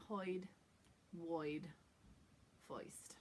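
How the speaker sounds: "Hoid", "void", "foist" with oy replacing the R; New York oy. Shown as a demonstration. Teacher lists words that do that, New York oy. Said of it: "other words where they do that".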